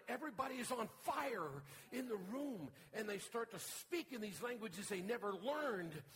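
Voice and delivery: loud, high, thundering sermon climax; fiery preaching. Acted. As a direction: preach it at full force, rising to a shout. Preacher narrating Pentecost at full force.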